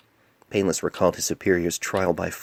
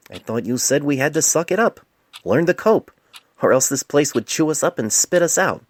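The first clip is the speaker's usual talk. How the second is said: indignant protest; 8 semitones higher, 6 dB louder than usual. A narrator's reading. Painless protests.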